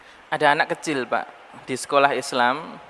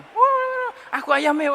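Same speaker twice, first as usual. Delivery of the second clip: put-on much higher, eager child voice; excited child; slower than usual. Preacher imitating a child grabbing chicken, high and eager. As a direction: play a greedy kid spotting the chicken.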